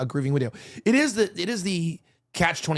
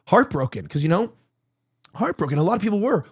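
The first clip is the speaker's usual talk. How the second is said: ordinary delivery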